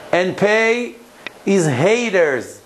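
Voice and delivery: slow, measured verse with pauses between phrases; solemn, drawn-out recitation; scripture reading. Acted. Reads scripture verse aloud, translated.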